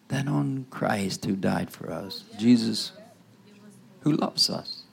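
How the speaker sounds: ordinary talk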